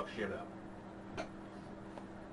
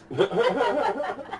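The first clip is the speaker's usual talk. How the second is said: a loud, high burst of laughter; 10 semitones higher, 7 dB louder than usual. Real, not acted. Telling friends about the girl with the shoe, laughing loudly. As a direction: laugh out loud at the absurd memory.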